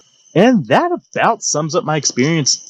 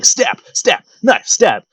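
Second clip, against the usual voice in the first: mock yell; 9 semitones higher, much slower than usual.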